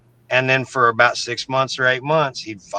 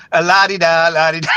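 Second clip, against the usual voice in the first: higher, louder la-di-da in a breezy singsong; mock carefree singsong; 4 semitones higher, 5 dB louder than usual. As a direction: play innocent, humming along as if nothing is happening. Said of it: "playing like they didn't know what was going on".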